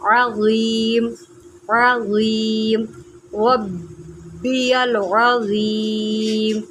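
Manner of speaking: عظیم slowly with a throaty ain and clear za; drawn-out سبحان ربی العظیم, each letter from its place; correct recitation. Shown as a demonstration. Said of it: "میں نے عین کو عین نکالا".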